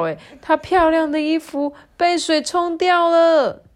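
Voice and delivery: high, loud storybook wail; panicked cry. A narrator's reading. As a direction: cry out high, stretching the final word. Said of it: "小猪吓得乱叫". (又高又响地惊慌大叫)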